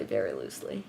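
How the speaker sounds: Very loosely muttered low and soft; quiet aside. Shown as a demonstration. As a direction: drop Very loosely under the breath. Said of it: "very loosely inspired look".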